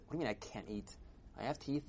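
soft, matter-of-fact protest; unbothered reply. Acted. Old man replies to shouting crowd, quiet and unbothered. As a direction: answer quietly, as if it is obvious.

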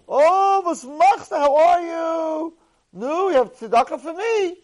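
very high, loud, sing-song greeting, drawn out; imitating a beggar's loud high-pitched welcome; overjoyed beggar greeting. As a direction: gush over an old friend to get a donation. Poor man greets, asks for tzedakah.

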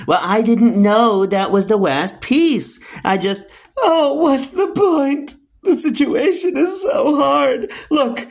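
high-pitched wail of self-pity; melodramatic, high-pitched complaining; whiny kvetching. Acted. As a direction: whine as if it's a huge tragedy. Mother whining over petty complaints.

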